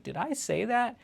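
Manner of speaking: high, innocent "did I?" voice; mock innocent Trump. Trump walking back his dictator comment, mock innocent. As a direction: feign wide-eyed innocence. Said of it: "He's like".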